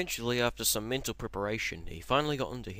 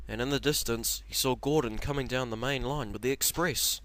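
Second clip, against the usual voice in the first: ordinary delivery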